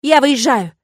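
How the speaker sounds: high, loud shout into phone. Acted. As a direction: yell it out. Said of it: "кричу в трубку я".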